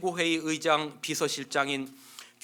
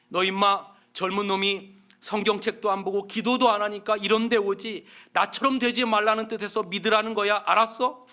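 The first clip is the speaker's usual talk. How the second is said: loud, higher rough scolding; gruff lecture; 5 semitones higher, 6 dB louder than usual. Acted. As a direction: lecture the young punk hard. Inmate lecturing newcomer.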